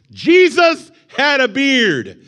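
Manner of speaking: slow, high, loud proclamation; all caps. Retold or imitated. Preacher reads an all-caps comment as a slow, loud cry. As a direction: boom it out like a battle cry. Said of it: "he writes in all caps".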